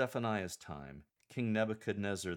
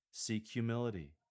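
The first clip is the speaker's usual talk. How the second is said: ordinary talk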